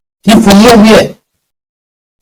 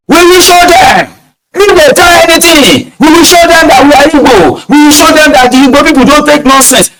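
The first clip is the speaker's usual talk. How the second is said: high, hammed-up rabble-rouser shout; pitched-up mock war cry; mock agitator voice; 12 semitones higher than usual. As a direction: mimic a hotheaded online troll.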